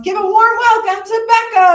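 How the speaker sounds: loud, high hype intro; hype announcer. Real, not acted. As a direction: pump the crowd up. Introducing next act.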